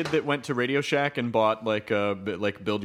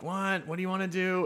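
puzzled executive; 7 semitones higher, 5 dB quieter than usual. Retold or imitated. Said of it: "And it was like".

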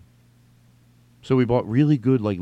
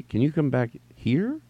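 slower, questioning stretch on "here"; working it out; 3 semitones higher, 3 dB quieter than usual. Acted. Reader almost solving the typo, slow and tentative. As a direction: sound it out slowly up to "here". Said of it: "and then here's you".

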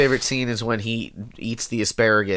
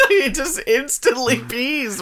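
high, slow words through laughter; laughing; 12 semitones higher, slower than usual. Real, not acted. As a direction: talk while cracking up. Laughing at the joke.

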